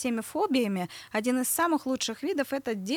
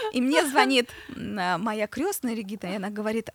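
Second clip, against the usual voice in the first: ordinary delivery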